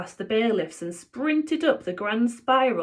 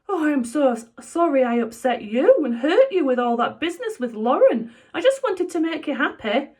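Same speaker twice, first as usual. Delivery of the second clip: high, loud emotional father; pleading apology, high; sorry dad; 7 semitones higher, 5 dB louder than usual. Acted. Dad apologising to Joe for the Lauren business, high and emotional.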